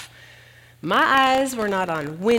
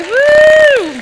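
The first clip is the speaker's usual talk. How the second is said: very high, very loud joyful woo; victory whoop; 18 dB louder than usual. Retold or imitated. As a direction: whoop like you just crossed the finish line. Celebrating at finish line.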